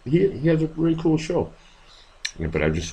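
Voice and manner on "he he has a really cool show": excited. Real, not acted. Enthusing about Gorf's show.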